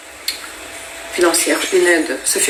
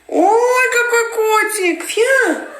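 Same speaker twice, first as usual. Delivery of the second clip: very high cooing pet voice, slowed; much slower than usual. Real, not acted. Cooing at her cat Rumi in high baby talk. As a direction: melt over the kitty.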